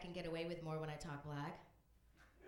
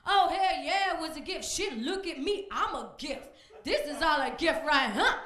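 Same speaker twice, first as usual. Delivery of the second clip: louder, higher boastful guy; loud high brash man voice; 7 semitones higher, 12 dB louder than usual. Acted. A cocky defendant ranting about the woman who sued him. Tyrone bragging.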